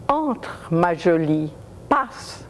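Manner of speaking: hammed-up French accent, slow; exaggerated French. Man ushering her in, in exaggerated French. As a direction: drawl it in mock French. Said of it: "saying in exaggerated French".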